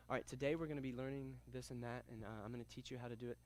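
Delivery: very low, hushed monotone, rattled off; quick, quiet boring-teacher demo; unenthusiastic instructor. Shown as a demonstration. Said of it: "I sounded like this right here".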